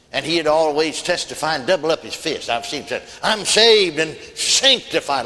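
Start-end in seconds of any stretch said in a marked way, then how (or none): 3.2-5.2 loud, drawn-out challenge to the room; slow, forceful mock testimony; challenging. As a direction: testify as if daring folks to argue. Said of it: "like he's challenging folks".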